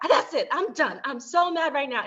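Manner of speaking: fed up and storming off; mock angry outburst. Wife fuming at husband over his car purchase, mock angry. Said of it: "I was like".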